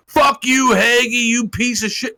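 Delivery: hammed-up angry yell; mock furious outburst. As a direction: spit it out in mock fury. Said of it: "that's when he fucking lost it".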